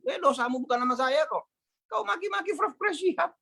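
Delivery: high, rising mock scold; scolding reply. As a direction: tell him off sharply. [name] scolds caller on phone.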